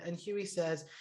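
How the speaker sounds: ordinary talk, nothing marked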